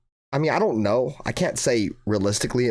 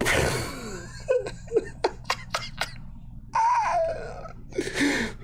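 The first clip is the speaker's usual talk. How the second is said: laugh; 5 dB quieter than usual. Real, not acted. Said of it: "laughing after fake sobbing".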